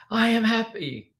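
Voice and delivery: high, drawn-out imitated yell; re-enacted high-pitched shout. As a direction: holler it slowly to passers-by. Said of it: "and they're yelling".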